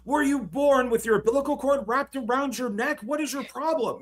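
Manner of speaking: high-pitched exasperated wizard rant; hammed-up Gandalf scolding; mock Gandalf rant. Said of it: "that was a horrible accent".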